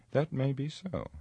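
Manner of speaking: lightly voiced haughty wizard, pitched high; Saruman, haughty wizard. Saruman answers Gandalf's call for union, haughty. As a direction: raise the pitch in lofty, grudging reply.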